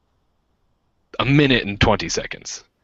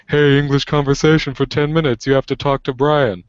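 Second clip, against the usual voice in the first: higher, louder upbeat announcing; eager wingman voice; 7 semitones higher, 4 dB louder than usual. Retold or imitated. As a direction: sell it like an eager hype man. Friend Kevin quoted as wingman.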